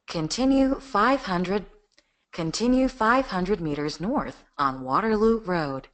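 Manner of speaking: slow, even satnav announcer; GPS voice. Shown as a demonstration. Voice actor demoing for the host, slow GPS voice. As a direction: read each direction slowly like a navigation system. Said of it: "you want to hear the G P S voice".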